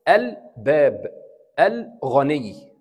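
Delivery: slow الباب and الغني, each with a clear "al"; spaced-out examples الباب, الغني, L fully sounded; lam pronounced. Shown as a demonstration. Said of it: "بنطق اللام".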